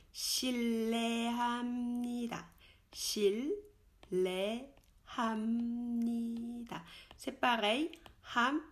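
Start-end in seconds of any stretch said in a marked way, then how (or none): 0.1-6.8 실례합니다 drawn out slowly, shil-le-ham-ni-da, h sounded; slow careful 실례합니다; slow demo. Shown as a demonstration. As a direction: lay out 실례합니다 syllable by syllable.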